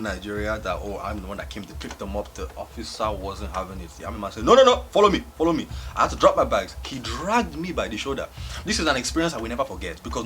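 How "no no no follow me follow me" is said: loud, high imitated soldier's order; mock officer's sharp command, starting loud; stern command. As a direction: order him along insistently. Irate soldier ordering him along.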